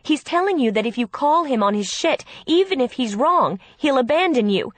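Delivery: exasperated, forceful argument; angry insistence. A narrator's reading. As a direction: hammer the truth home to your sister. Sister argues against cheating fiancé.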